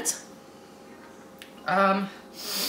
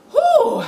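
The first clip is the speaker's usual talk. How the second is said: high loud exhale through burning mouth; sharp whew; 6 dB louder than usual. Real, not acted. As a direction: blow out the heat with a sharp whew. Exhaling from pepper burn.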